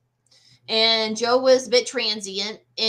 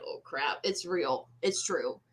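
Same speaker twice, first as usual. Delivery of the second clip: hushed dread; 5 semitones lower, 9 dB quieter, slower than usual. Retold or imitated. Realizing the ghost is real.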